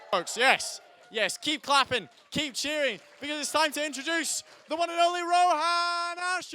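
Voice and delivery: excited announcer introduction; hype intro. Real, not acted. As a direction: pump up the crowd, rising into the name. Host hypes crowd for act.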